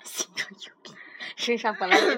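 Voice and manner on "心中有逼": breathy playful whisper. Acted. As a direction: whisper it back like a secret. Whispered echo of partner's quip. (悄声耳语)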